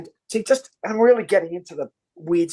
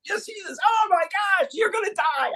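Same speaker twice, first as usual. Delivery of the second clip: much higher, frantic mock panic; hammed-up panic; 14 semitones higher than usual. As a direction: play the terror big. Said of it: "panicked oracle scene".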